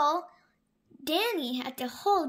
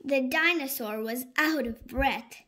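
ordinary delivery

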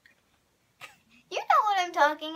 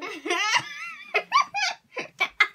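high helpless laugh. Real, not acted. Laughing at the makeup.

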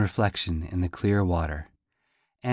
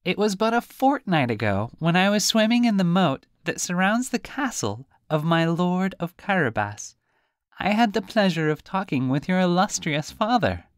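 high, squeaky little fish voice; high teasing fish; talking fish; 9 semitones higher than usual. Acted. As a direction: play a cheeky fish, much higher. Fish tells of the father.

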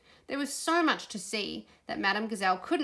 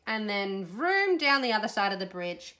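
ordinary delivery, nothing marked

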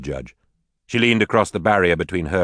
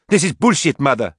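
high, loud furious retort; angry outburst; 8 semitones higher, 5 dB louder than usual. Acted. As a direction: spit it back at her mother. Daughter furious at mother.

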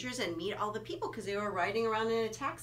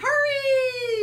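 high, loud echoing yell; loud shout; 13 dB louder than usual. Pretend. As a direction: shout it like a clown in a rush. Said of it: "please try to hurry".

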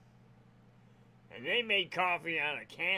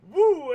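retold yelp; 11 semitones higher, 15 dB louder than usual. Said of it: "And I was like".

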